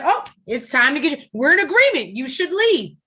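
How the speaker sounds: mock-sweet high retelling; gracefully sweet quote. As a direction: play the polite exit, lightly sweet. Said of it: "gracefully she treated that".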